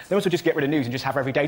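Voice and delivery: ordinary talk, nothing marked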